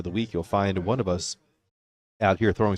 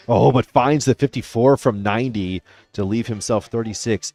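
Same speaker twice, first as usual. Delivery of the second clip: louder, lifted play-by-play burst; excited call; 4 semitones higher, 8 dB louder than usual. Real, not acted. Live call of a good dart.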